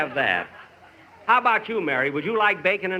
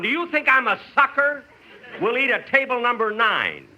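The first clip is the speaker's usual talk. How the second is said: indignant; 6 semitones higher than usual. Acted. Indignant at being called sucker.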